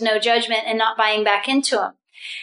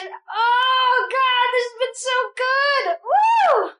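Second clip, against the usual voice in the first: much higher, sing-song and then a whooping cry; ecstatic whoop; 11 semitones higher, much slower than usual. Real, not acted. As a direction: let the joy spill into a whoop. Ecstatic close of group session.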